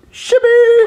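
squeaky whimper. Acted. Hostage squeaks password.

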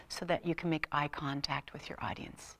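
whisper. Acted. Drops to whisper for emphasis.

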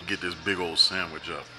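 ordinary talk

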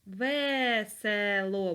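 very slow "Весело" with a clear Е; slow dictation. Shown as a demonstration. Teacher dictates "Весело" for the spelling. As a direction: draw out "Весело" so the Е is heard. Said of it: "Буква Е".

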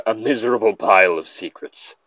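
ordinary talk

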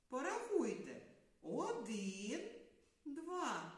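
usual speaking voice

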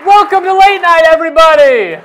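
high, loud show-opening holler; shouted welcome. Real, not acted. Host greeting the studio audience as the show opens, excited shout. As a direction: shout it out over the cheering crowd.

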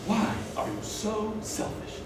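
very soft, slow plea; nearly whispered, drawn-out exasperation; weary reproach. Acted. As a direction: ask it quietly, at the end of your tether. Weary son pleading with mother.

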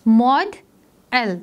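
"mod", pause, "L", each part set apart; split into syllables. Shown as a demonstration. Teacher breaking a word into syllables for pupils, syllable by syllable. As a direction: say "mod L" in two separate beats. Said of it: "आवाज़ हमारी three times break हुई है".

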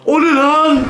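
high, sharp cry of fright; startled yelp. Real, not acted. Gamer jumps at a sudden shape, startled yelp. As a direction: caught off guard by something scary.

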